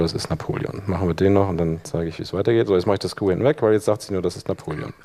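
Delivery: normal talking voice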